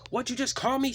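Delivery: very high, loud outraged snap; snapping. Acted. James outraged at being insulted, high loud snap. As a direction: shoot the question back in outrage. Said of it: "snapped James".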